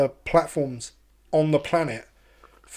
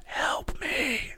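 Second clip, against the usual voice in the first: higher, breathless whispered plea from behind the door; eerie whisper; 9 semitones higher, 9 dB quieter than usual. Retold or imitated. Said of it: "you hear someone going".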